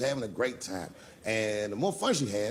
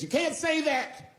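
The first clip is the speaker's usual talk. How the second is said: high, drawn-out mock wail; crying heckler; slower than usual. Upset audience woman objects to the comic, high mock cry. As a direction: imitate an upset woman, pitched way up. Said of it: "she was crying".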